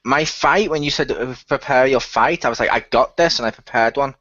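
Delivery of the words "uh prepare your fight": ordinary talk, nothing marked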